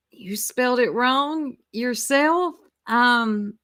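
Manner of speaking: normal talking voice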